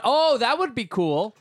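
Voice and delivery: quick bright hopeful lift; excited thought. Retold or imitated. Quoting own excited thought.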